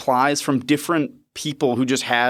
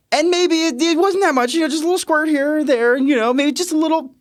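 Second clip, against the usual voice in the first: high playful; 13 semitones higher than usual. Acted. Confiding embarrassing point.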